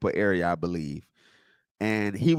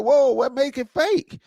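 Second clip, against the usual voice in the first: mock surprise; 13 semitones higher, 4 dB louder, slower than usual. Retold reply to the troll.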